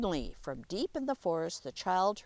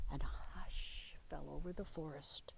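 whispered, much softer and lower; hushed; 7 semitones lower, 12 dB quieter than usual. A narrator's reading. The narrator tells of the forest falling silent, whispering. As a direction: let the words go silent.

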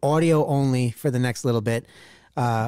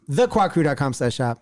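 ordinary talk, nothing marked

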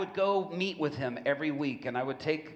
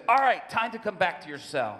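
guard calling out; 6 semitones higher, 8 dB louder than usual. Retold or imitated. Guard calls prisoner back to cell.

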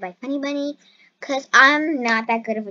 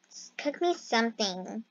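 ordinary talk

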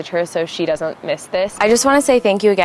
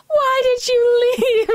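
high, slow, loud crying plea; bawling; 4 dB louder, much slower than usual. Retold or imitated. Girlfriend imitating her boyfriend bawling when he found her, slow high crying. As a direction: wail it out through tears. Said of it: "she starts bawling".

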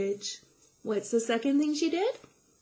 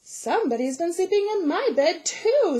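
higher, loud, indignant mama bear; 7 semitones higher, 6 dB louder than usual. A narrator's reading. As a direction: complain as the middle-sized mama bear. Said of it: "said middle-sized Mama Bear".

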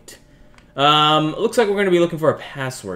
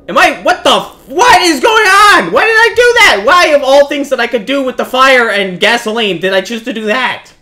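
high, loud disbelieving yell; exasperated outrage; 7 semitones higher, 7 dB louder than usual. Real, not acted. Player appalled by the fire they set, exasperated shouting. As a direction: shout in appalled disbelief, easing slightly by the end. Appalled at own action in game.

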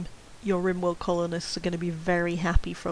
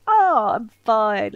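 overconfident; 3 semitones higher, 10 dB louder, much slower than usual. Retold or imitated. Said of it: "where you're like".

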